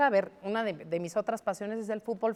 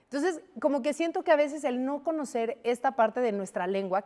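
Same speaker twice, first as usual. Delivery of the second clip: normal talking voice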